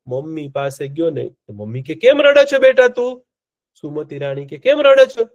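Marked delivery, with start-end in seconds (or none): 1.9-3.2 higher and louder, anxious asking; a mother rushing to her crying boy; worried mother. A narrator's reading. Mother asks her crying son why he weeps, higher and louder with worry. 4.6-5.3 higher and louder, anxious asking; a mother rushing to her crying boy; worried mother. A narrator's reading. Queen Sumati asks her crying son why he weeps, higher and louder with worry.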